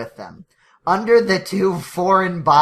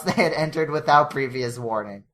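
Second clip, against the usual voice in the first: usual speaking voice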